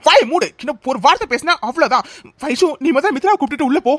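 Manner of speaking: low-pitched, stern telling-off; stern scolding. Acted. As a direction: shut them up with a low, firm warning. Elder scolding, ordering silence.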